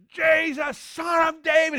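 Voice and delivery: high-pitched full-out shout; yelling. Shown as a demonstration. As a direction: cry out over a noisy crowd. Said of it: "He's just yelling this. Top of his lungs".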